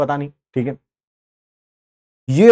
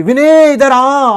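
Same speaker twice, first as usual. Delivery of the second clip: high, loud drawn-out summons; slow, raised call across the street; 7 semitones higher, 5 dB louder, much slower than usual. Retold or imitated. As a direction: imitate an uncle calling a boy over. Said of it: "विनय को आवाज मार ली".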